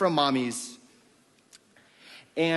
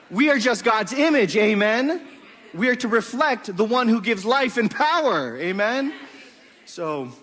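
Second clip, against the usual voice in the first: preaching; 3 semitones higher, 5 dB louder than usual. Real, not acted. Pastor calling the church to answer amen, fervent preaching. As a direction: build the call, loud and rising.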